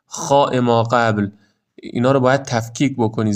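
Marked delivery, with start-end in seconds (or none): none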